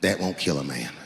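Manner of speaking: deep clerk voice. Retold or imitated. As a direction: drop way down like the old gun clerk. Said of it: "he goes just like this".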